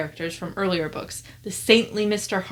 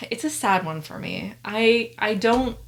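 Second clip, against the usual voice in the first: normal talking voice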